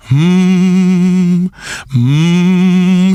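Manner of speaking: low buzzing hum; resonant hum. Acted. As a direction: hum so the lips vibrate. Humming into his hand.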